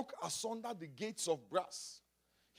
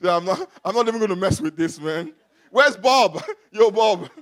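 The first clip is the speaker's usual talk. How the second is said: loud, laughing mock brush-off; impatient American boss; 2 semitones higher, 17 dB louder than usual. Preacher imitating a hiring man calling out for Bob, loud and amused. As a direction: wave the long name away and shout for Bob. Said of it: "The guy just look at you and just say".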